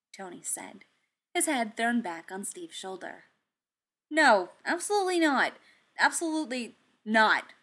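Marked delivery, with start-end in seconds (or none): 4.1-7.5 higher and louder, flatly refusing; emphatic refusal. Acted. Tony rejecting the plan, loud repeated no. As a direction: stamp the no down twice.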